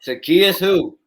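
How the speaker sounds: who on earth is that; loud disbelief, then a quick apology; baffled who. Real, not acted. Baffled by an unknown receiver name.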